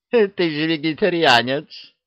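put-on high, cackling old witch voice; teasing witch. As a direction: needle him like a sly old hag. (ехидная ведьма)

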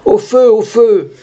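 high, drawn-out call of alarm; slow, high-pitched theatrical alarm; fire alarm cry. Acted. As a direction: cry fire to the whole street.

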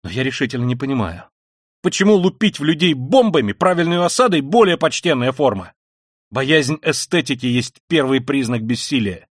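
high, loud, impassioned ranting; frenzied tirade. Acted. Raskolnikov ranting at his sister, frenzied and loud. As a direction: hurl it all out in frenzy.

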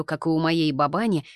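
ordinary delivery, nothing marked

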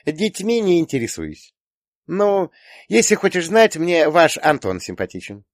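put-on high, dismissive girl; haughty. A narrator's reading. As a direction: brush it off in a high voice. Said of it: "пренебрежительно фыркнула девушка". (пренебрежительно, высоким девичьим голосом)

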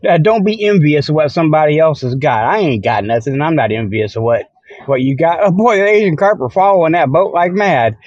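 ordinary delivery

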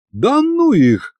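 high, drawn-out dismissal; slow, exasperated brush-off; dismissive brush-off. A narrator's reading. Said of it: "отмахнулся я".

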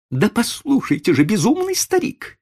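very high, exasperated insistence; insistent lady. A narrator's reading. Lady pressing the old man to sell, insistent. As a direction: insist in a high-pitched lady's voice. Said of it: "настаивала дама".

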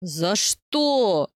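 understated outraged bellow; man roaring in indignant protest. A narrator's reading. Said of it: "с возмущением взревел".

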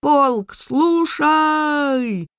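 high, drawn-out parade-ground call; loud soldier's command, held long; shouted command. A narrator's reading. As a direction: call the regiment to order. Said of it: "закричал солдат".

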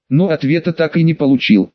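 usual speaking voice

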